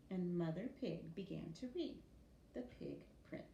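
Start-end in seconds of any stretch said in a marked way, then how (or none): none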